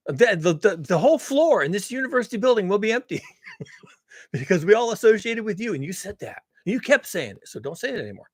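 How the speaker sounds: high, loud, worked-up pleading rant; mock scolding. Bosses in the hallway warning their colleague, mock exasperated scolding. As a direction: imitate alarmed bosses telling off a colleague.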